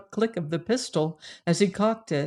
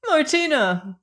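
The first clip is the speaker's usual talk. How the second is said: much higher-pitched call; 9 semitones higher, 4 dB louder than usual. A narrator's reading. Joanna calling to the man who never paused, calling out. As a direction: call the name after someone walking away. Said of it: "she called".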